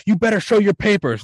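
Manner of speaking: high, loud mock demand; Dwight. Son challenging his father over papers, loud officious impression. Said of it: "like, Dwight from The Office or something".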